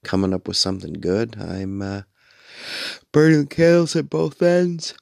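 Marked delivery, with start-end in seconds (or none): 3.1-4.9 high, loud yawn through the words; words stretched up high by a yawn. Real, not acted. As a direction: talk right through a big yawn. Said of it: "sorry to yawn in your ear".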